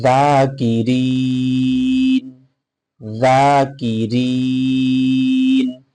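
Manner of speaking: জাকিরিন chanted slowly with a long final; drawn-out tajweed; slow Quran recitation. Shown as a demonstration. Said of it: "উচ্চারণ করার চেষ্টা করি".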